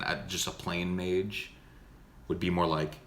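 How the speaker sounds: normal talking voice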